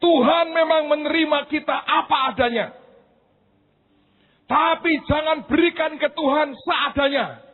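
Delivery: high, loud sermon cry; preacher's shout. Retold or imitated. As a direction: proclaim it like she did. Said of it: "ketika dia teriak". (seruan khotbah yang tinggi dan keras)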